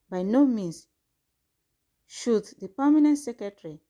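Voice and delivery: measured, formal news read; formal statement reading. Acted. Reads out formal press statement.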